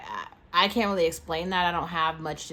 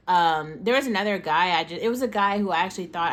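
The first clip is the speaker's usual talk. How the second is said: ordinary delivery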